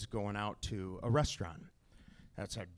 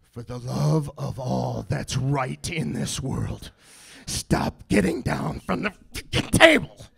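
slow furious plea; 10 dB louder than usual. Acted. Dad at wit's end with kid leaving table, building fury. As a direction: build from gritted plea to full yell.

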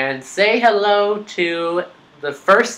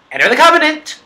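high, loud urging cry; excited shout; 11 semitones higher, 5 dB louder than usual. Real, not acted. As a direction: shout the command eagerly. Urging a teammate.

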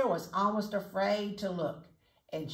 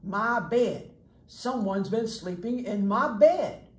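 Papa Bear; 2 semitones higher, 6 dB louder, slower than usual. Acted. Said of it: "Papa Bear".

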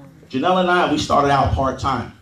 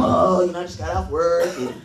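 mock excuse. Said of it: "the excuse is gonna come".

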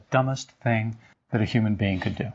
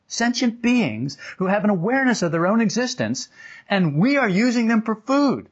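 stunned realization; 10 semitones higher than usual. Retold or imitated. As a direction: let the horror of it dawn and grow. Sudden realization about cows.

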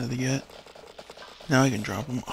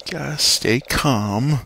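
slow, louder, drawn-out self-command; forced calm; 2 semitones lower, 6 dB louder, much slower than usual. Real, not acted. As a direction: spell it out slowly and firmly. Forcing himself calm after anger.

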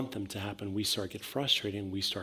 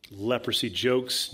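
ordinary talk

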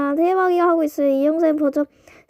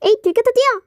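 excited; 7 semitones higher, 3 dB louder, faster than usual. Acted. Caught, runs away.

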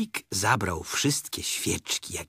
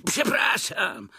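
high, drawn-out boisterous apology; boisterous Tigger; 10 semitones higher, 4 dB louder, slower than usual. A narrator's reading. Tigger apologising after a collision, high and boisterous. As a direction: sing out the apology, high and slow. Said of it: "bardzo rozbrykany".

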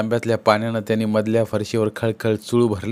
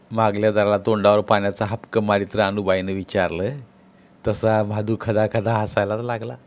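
usual speaking voice

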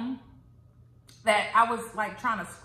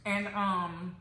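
chewing between words; talking around food; mouth full of food; 5 dB quieter, slower than usual. Real, not acted. Seen 2: Fries put in mouth at -3; chewing, puffed cheeks during words.

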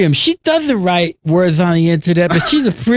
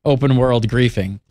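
lisp; 7 semitones lower than usual. Pretend. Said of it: "talk with that lisp".

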